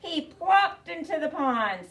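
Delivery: ordinary delivery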